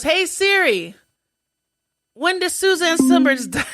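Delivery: high, loud imitation of calling an assistant; loud voice command. Patient asking her phone in public, loud imitation. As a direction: call out the query to the phone.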